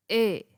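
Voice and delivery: ए said alone, drawn out; teacher sounds out ए. Shown as a demonstration. Teacher demonstrating ए vowel to students, isolated. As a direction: model the ए vowel on its own. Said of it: "ए की sound है".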